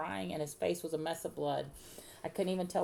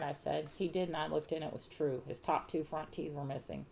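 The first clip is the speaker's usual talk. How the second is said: ordinary delivery, nothing marked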